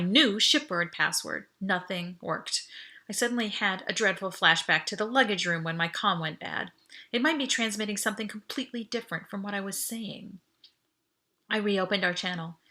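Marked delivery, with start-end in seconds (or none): none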